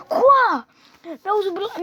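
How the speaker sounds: high, loud incredulous "Quoi?"; stunned disbelief. Real, not acted. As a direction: blurt it out, baffled. Reacts to absurd game option.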